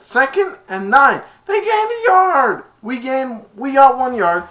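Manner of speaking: high, loud cheering calls; excited shouting. Retold or imitated. The team yelling about the yard gained, high excited cheer. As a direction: celebrate every inch loudly.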